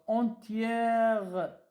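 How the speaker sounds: entière drawn out very slowly; syllable dictation. Shown as a demonstration. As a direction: break entière apart for dictation. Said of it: "Je suis entièrement".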